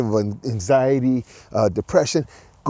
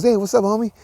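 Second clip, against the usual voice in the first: homeless guy voice; 8 semitones higher, faster than usual. Retold or imitated. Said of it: "I like the voices".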